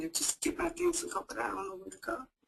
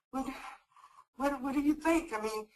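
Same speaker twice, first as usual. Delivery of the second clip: ordinary talk, nothing marked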